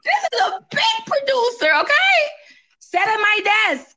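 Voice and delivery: can't hold in the thrill; excited. Real, not acted. Bragging to her friend about the big producer, high and excited.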